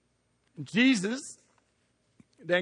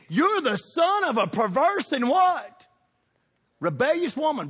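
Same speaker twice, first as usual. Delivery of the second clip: Saul's anger; 10 semitones higher, 4 dB louder than usual. Acted. Saul insulting his own son Jonathan, loud and furious. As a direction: hurl it at him again. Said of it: "He said".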